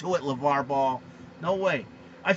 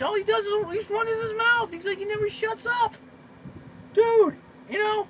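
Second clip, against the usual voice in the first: exasperated rant. Real, not acted. Ranting about LeVar Ball.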